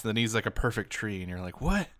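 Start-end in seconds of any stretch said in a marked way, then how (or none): 1.6-1.9 high-pitched, astonished questions; amazed disbelief. Retold or imitated. Viewer watching Bob Ross paint a tree, amazed disbelief. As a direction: imitate a stunned viewer, pitch shooting up.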